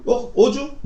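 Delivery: high, cheeky scoff; a taunting 'oh really?'; mocking scoff. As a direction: sneer at their nerve. God mocking defiant people.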